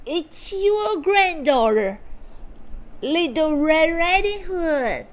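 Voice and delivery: high put-on girlish voice; wolf faking a girl. A narrator's reading. Wolf at the door posing as granddaughter, high fake girl voice. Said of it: "said the wolf".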